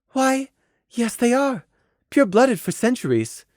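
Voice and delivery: high, polite, restrained voice; wan, ladylike. Acted. Mare speaking of her noble parents, wanly polite. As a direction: answer through a weak smile. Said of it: "said with a s-, wan smile".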